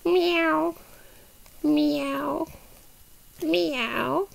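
soft, drawn-out cat meows; imitated meow. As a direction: mew gently to lure a cat. Grogu doll imitates a cat.

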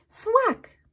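ordinary delivery

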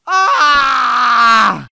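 very loud, high screaming cheer; ecstatic scream. Real, not acted. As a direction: scream with joy at the kill. Reagindo ao golpe final. (grito eufórico)

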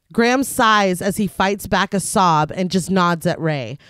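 measured read-aloud film summary; scripted recap narration. A narrator's reading. Podcast host reads the film's plot to co-hosts, measured narration. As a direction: narrate the plot evenly, sentences falling at the ends.

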